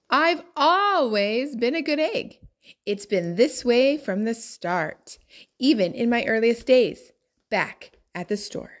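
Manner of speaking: ordinary delivery, nothing marked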